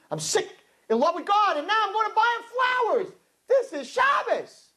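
high, soaring exuberance; excited. Retold or imitated. Woman buying flowers for God on Shabbos, high ecstatic excitement. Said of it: "I get excited, in case you can't tell".

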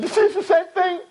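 put-on high, eager kid voice calling out; a keen student's mock shout. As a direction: play the smart kid blurting his answer. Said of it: "one kid screamed out".

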